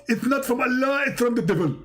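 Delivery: high, insistent preacher-angel declaration; mock scolding verdict, high then falling; Zakir Naik as Jibreel. Angel corrects Muhammad, hammed-up high preacher impression. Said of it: "You look like Zakir Naik".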